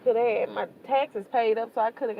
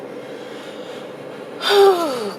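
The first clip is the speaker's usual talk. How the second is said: heavy sigh; 9 semitones higher, 6 dB louder than usual. Real, not acted. Overwhelmed mother, heavy sigh.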